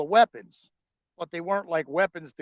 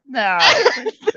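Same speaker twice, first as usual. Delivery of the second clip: loud, high-pitched brush-off; mock refusal; 9 semitones higher, 13 dB louder than usual. Man retelling his answer to wife's question, mock refusal. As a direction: blurt out a hammed-up no.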